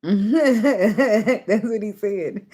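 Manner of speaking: slow words through laughter; talking while cracking up; laughing. Real, not acted. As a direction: laugh out the line. Laughing at herself.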